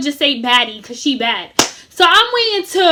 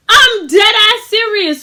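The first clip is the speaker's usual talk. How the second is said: insisting, half laughing; 6 semitones higher, 6 dB louder, slower than usual. Retold or imitated. Insisting while friends laugh.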